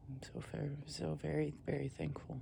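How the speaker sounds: soft, breathy whisper of thanks; barely voiced, overcome with gratitude; moved whisper. Real, not acted. Grateful camper whispers his thanks, hushed.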